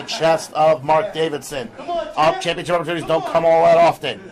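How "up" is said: sudden high excited call. Real, not acted. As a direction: jump up on the word. Live call of big move.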